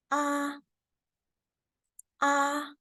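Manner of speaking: "Ah" held high and level; open-mouth dentist "Ah", high sustained pitch; first tone. Shown as a demonstration. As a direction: sustain "Ah" on one high, even note. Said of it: "It's high-pitched".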